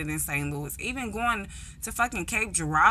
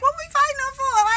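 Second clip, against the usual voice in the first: younger self protesting; 5 dB louder, faster than usual. Retold or imitated. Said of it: "I'm like".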